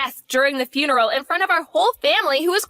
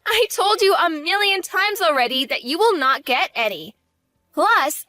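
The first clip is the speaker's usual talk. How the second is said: normal talking voice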